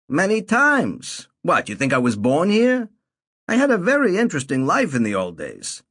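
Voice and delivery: a lightly voiced proud old man; old man Saul. A narrator's reading. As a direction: Saul bragging about his past life.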